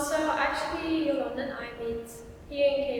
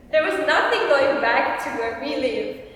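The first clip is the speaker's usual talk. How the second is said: louder, laughing start to the line; 10 dB louder than usual. Real, not acted. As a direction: laugh as you tell the punchline. Laughing at stranded story.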